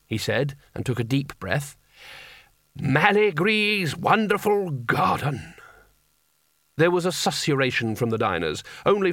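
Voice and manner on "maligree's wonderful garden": high, slow, theatrical proclamation; grand spell announcement. Acted. Wizard announces his spell to diners, grand and slow. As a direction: proclaim it slowly like a showman wizard.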